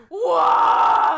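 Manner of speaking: loud, high, crying shout of why; dramatic wail. Acted. Husband on his knees wailing at wife, hammed-up crying shout. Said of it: "it was really dramatic".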